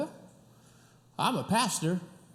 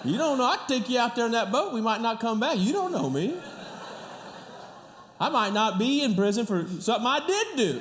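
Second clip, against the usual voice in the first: louder, higher teasing with laughs breaking through; joking mock threat; 8 semitones higher, 4 dB louder than usual. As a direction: play at menace while cracking up. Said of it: "No, I'm just kidding".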